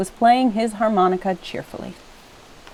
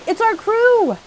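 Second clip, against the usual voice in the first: high, loud joyful calling; high, loud rescue cheer; excited shout; 8 semitones higher, 5 dB louder than usual. A narrator's reading. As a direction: call out across the water.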